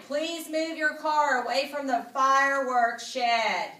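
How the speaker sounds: loud, high announcer calling over a PA; loudspeaker announcement. Acted. The fair's loudspeaker calling to the crowd, a loud announcer voice. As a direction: boom it out like a fairground loudspeaker. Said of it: "an enormous voice over the loudspeaker that said".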